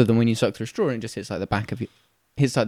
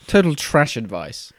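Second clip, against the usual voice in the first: ordinary talk, nothing marked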